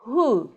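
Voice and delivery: "Who" with a breathed h, long oo; contrast-word demo. Shown as a demonstration. Teacher contrasting two similar words, careful articulation. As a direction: model "Who" clearly, h in place of y. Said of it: "then we contrast with".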